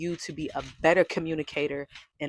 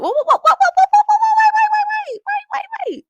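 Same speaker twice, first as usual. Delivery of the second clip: calling out; 14 dB louder than usual. Pretend. Calling viewer back before leaving.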